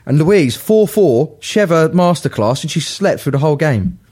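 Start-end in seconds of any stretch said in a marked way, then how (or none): none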